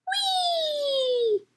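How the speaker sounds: very high, held whee of delight; a long bright squeal of fun; gleeful whee. A narrator's reading. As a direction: sail off on the rope like a child. Mom swinging on ropes.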